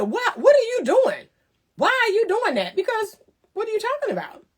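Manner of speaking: high shouted mock outrage; outraged critic. A critic yelling at Anita about her post, high mock outrage. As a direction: shriek it like a scandalized busybody.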